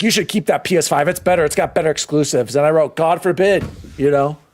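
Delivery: usual speaking voice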